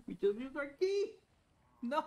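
high, loud outburst of dismay; dismayed yell. Real, not acted. Gamer who just used the key, dismayed shout. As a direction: yell it in disbelief.

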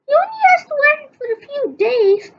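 quick high reassurance; high, fast nurse voice. Acted. Nurse explains the collar to Chief, high and quick.

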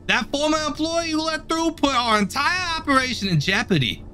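a stern foreman scolding his worker; gruff boss voice. Acted. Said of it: "that's exactly his voice".

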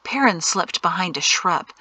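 ordinary delivery

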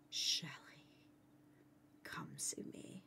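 hushed, airy whisper; coaxing whisper. Pretend. Player luring Shelly over to the stable, coaxing whisper. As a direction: whisper it as a secret summons.

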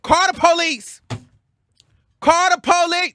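high-pitched, loud challenge; loud defiance. Retold or imitated. Daring the restaurant man during a quarrel, loud. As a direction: dare them at the top of your voice. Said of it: "Now I'm, now I'm loud".

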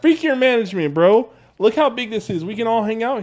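loud defiant. Acted. Defiant shouting.

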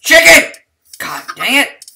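high loud exasperated yell; yelling at chickens. Real, not acted. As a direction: bellow at the chickens. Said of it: "Yelling at chickens again".

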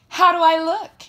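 high, loud show-off voice. A narrator's reading. Tammy models anklets for her classmates, loudly showing off.

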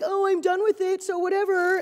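mock the litterer's careless shrug. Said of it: "It was just like".

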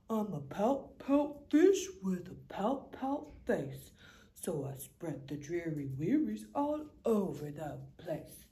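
low, dreary fish moaning his refrain; low mopey fish sighing blah blah; glum pout fish. Acted. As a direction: sink into the gloom of a sulky fish. Fish's gloomy refrain.

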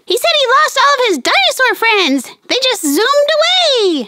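high, fast cartoon puppet chatter; squeaky puppet voice. Fizzy the puppet cheering Phoebe, high cartoon voice. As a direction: chirp it like a tiny cartoon friend.

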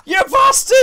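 screaming at the attacker; furious shout. Real, not acted. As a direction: yell it at full volume. Enemy attacks, player yells.

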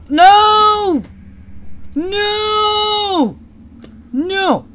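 loud, high shouted no; frustrated shouting. Real, not acted. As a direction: scream it in frustration. Losing to Undyne.

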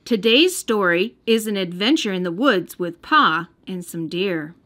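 plain reading voice

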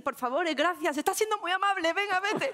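high, overly polite pleading; polite yell. Retold or imitated. To her instructor in class, a high polite plea. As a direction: shout thanks as if to an attacker. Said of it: "me salen".

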